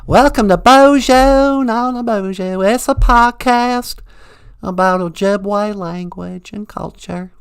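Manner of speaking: put-on southern accent. Puppet Emily loudly welcoming listeners in a southern accent. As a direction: belt out the intro in a thick southern drawl. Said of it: "really thick southern accent".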